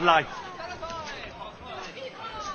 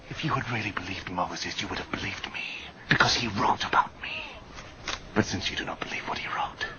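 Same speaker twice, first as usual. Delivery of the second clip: much lower, softer, whispered rebuke; 12 semitones lower, 8 dB quieter than usual. Acted. Jesus confronting the crowd quietly, whispering. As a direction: drop to an intimate whisper.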